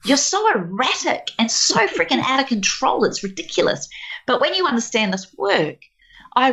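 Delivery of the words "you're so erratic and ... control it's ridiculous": exasperated criticism. Acted. Husband's imagined complaint back.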